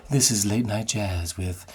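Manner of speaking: slow, breathy radio host voice; late night jazz host. Shown as a demonstration. Breathy late-night jazz radio host introducing the show. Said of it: "that very breathy sound".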